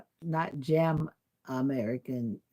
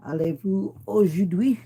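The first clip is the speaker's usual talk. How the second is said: louder, halting high school French; 6 semitones higher, 10 dB louder than usual. Shown as a demonstration. Answering French viewers, loud high school French. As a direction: try out rusty schoolbook French. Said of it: "testing my high school French".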